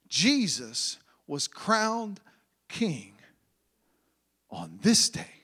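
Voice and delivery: quiet and slow reverent close; hushed. Acted. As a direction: let it sink to a solemn hush. Hushed climax of the sermon.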